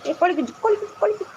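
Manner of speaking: playful calling. Real, not acted. Playful calling of an animal, chuckling.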